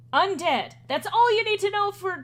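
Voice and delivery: loud, high, impatient answer; curt insistence. Acted. Edgar tells them what the curse is, impatient insistence. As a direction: shut down the questioning firmly.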